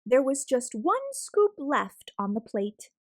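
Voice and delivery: usual speaking voice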